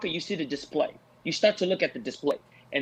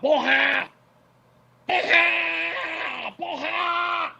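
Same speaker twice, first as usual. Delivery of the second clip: high, stretched-out victory cry, mimicked; mocking celebration cry; 11 semitones higher, much slower than usual. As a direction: shout it like a fighter celebrating a boring win. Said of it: "he jumps up talking about".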